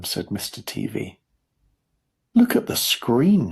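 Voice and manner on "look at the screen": slow, higher excited call; mild shout. A narrator's reading. Mister Wonka pointing to the screen, mild shout. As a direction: call out urgently, drawing it out. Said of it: "shouted Mister Wonka".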